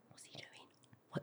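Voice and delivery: slow, hushed whisper. Retold or imitated. Friends whisper about the boy, slowly hushed. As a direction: whisper it behind your hand. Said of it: "my friends used to go".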